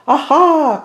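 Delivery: high loud aha; triumphant aha. A narrator's reading. Mouse popping out of the cake, crying a high aha. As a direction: pop out with a triumphant cry. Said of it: "cried Mouse".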